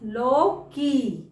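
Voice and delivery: slow model word. Shown as a demonstration. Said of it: "हम क्या बोलते हैं?".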